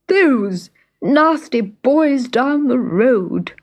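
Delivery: slow, aggrieved dog voice; whimpering dog. A narrator's reading. Dog telling the fairy who hurt him, whimpering. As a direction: whimper it out slowly, sorry for yourself. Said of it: "whimpered the dog".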